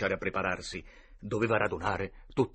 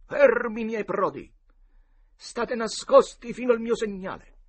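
high-pitched command to his band; heroic boy captain; 11 semitones higher, 8 dB louder than usual. A narrator's reading. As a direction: order your brave men into hiding. (capitano che comanda i suoi prodi)

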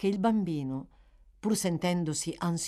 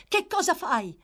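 alarmed cry; 12 semitones higher, 5 dB louder than usual. A narrator's reading. Oliver crying out in alarm.

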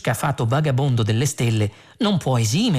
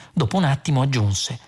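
usual speaking voice

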